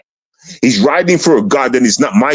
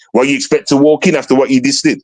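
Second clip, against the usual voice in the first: ordinary talk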